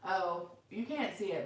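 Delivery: ordinary delivery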